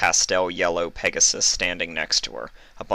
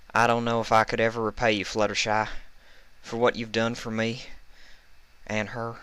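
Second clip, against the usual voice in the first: lightly voiced country mare, quick and thankful; a touch higher, earnest thanks; grateful farm pony, lightly voiced; grateful Applejack; 5 semitones higher than usual. A narrator's reading.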